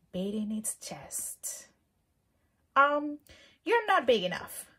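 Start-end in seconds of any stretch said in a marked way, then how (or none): 2.7-4.7 high, loud, cheeky challenge; grouchy ladybug voice. Acted. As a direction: pick a fight in a squeaky bug voice. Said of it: "said the grouchy ladybug".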